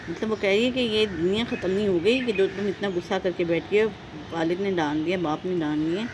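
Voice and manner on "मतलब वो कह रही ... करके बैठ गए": usual speaking voice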